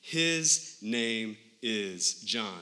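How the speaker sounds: each word of "His name is John" slow and weighty; slow firm declaration. Retold or imitated. Zechariah writes his son's name, slow and firm. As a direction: lay it down slowly, word by word. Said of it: "verse sixty-three".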